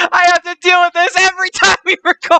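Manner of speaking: high, fast exasperated whine; exasperated complaint. Acted. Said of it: "Rico does this shit every time".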